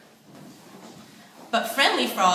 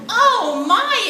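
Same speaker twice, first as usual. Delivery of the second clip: much higher and louder, a startled gasp; shocked exclaim; 10 semitones higher, 9 dB louder than usual. A narrator's reading. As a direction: cry out at the mess. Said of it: "exclaimed Fancy Fish".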